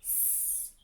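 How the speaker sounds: unvoiced hiss, S; S as a hushed snake sound. Shown as a demonstration. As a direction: breathe out a soft S hiss. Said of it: "the letter S makes the sound".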